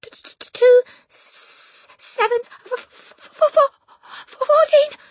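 very high, loud, stammering gasp; Rupert gasping. A narrator's reading. Dangling boy answering the headmistress, gasping stammer. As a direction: stutter it out in high-pitched terror. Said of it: "gasped Rupert".

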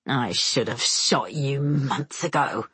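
low, slow menacing hiss; hissed threat. A narrator's reading. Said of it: "Semyon hisses".

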